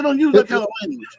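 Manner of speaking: high, reproachful scold. Retold or imitated. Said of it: "I said".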